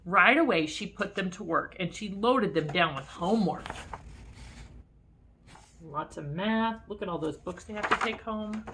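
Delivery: ordinary delivery, nothing marked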